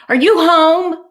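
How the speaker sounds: loud, high calling-out; yelling. Acted. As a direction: call through the door at the top of your voice. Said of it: "someone yelled outside".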